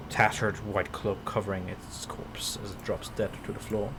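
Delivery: ordinary talk, nothing marked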